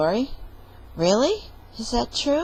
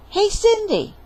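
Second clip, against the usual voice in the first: high, loud hail across a distance; calling out; 12 semitones higher, 6 dB louder than usual. Acted. Jack calling to Cinderella from the beanstalk, loud. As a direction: call up to her.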